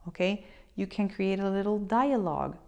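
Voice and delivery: usual speaking voice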